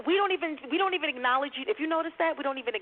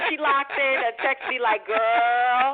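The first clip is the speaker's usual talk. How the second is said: excited; 9 semitones higher than usual. Real, not acted. Excited shout-out to a friend.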